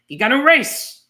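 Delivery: high, loud bark of a command; mock villain's shout; sharp shout. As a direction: shout it at them.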